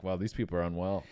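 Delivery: deadpan muttered verdict; quiet judging aside. Retold or imitated. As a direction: murmur it as a shopper walking past. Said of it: "they would just look at you".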